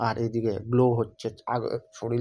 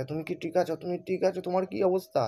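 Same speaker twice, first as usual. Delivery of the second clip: put-on worried girl; 6 semitones higher than usual. Girl worried after beating.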